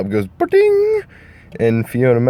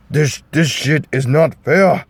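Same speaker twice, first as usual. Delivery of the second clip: mock Scottish ogre voice, higher; a clumsy Shrek voice, more like a bad Russian; hammed-up ogre whining it's not fair; bad Shrek impression; 5 semitones higher, 3 dB louder than usual. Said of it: "pardon my Shrek impersonation".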